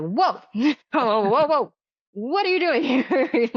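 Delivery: half-laughing startled cry; startled whoa. Retold or imitated. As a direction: jump back from a sudden visitor. Spirit appears at night, upset.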